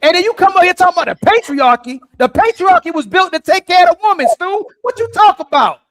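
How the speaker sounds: loud, high-pitched arguing; heated challenge. Real, not acted. Challenging Stu in heated debate.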